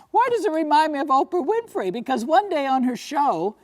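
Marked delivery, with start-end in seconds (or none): 0.1-1.9 laughing question. Real, not acted.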